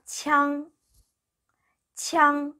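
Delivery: 창 as a gliding 치양, high and level; teacher's sustained high 창; model pronunciation. Shown as a demonstration. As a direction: hold 창 long with a y-glide after the ch. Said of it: "이렇게 읽으시면 되고요".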